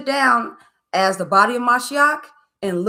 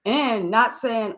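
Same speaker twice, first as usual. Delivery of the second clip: ordinary delivery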